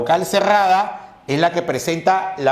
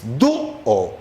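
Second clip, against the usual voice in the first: "dúo" with the ú stressed, slow and lower; 5 semitones lower than usual. Shown as a demonstration. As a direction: lean on the ú in "dúo". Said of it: "¿Cómo es?". (acento en la ú de "dúo")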